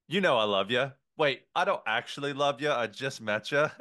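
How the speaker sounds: put-on Aussie waiter, joshing; fake Australian accent. Waiter kidding a customer he just met, fake Australian accent. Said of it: "seemingly fake Australian accent".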